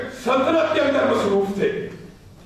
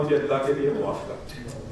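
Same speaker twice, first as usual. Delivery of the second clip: humble plea; 9 semitones lower, 7 dB quieter than usual. Retold or imitated. Umar begs the young man, hands folded.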